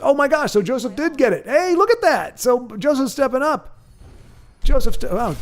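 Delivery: high, exclaiming delight; thrilled and impressed; excited surprise. Real, not acted. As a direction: cheer someone on as pitch leaps up. Delighted viewer got the reference.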